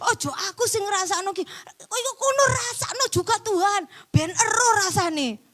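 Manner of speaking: high, whiny retold complaint; mock protest. Preacher re-enacting their old protest to God, high mock indignation. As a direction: plead indignantly, pitched high and hammed-up. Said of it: "Saya protes sama Tuhan seperti itu".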